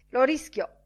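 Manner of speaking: high, loud, pushy greeting voice; brash loud Bantam Lyons. Acted. Bantam Lyons hails Bloom for his newspaper, loud and high. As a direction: barge in loudly and grab the paper. Lyons grabs the tip excitedly.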